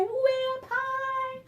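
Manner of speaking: very high, slow, sung-out voice. Shown as a demonstration. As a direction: stretch the voice up to the top. Finger play, voice follows reaching up.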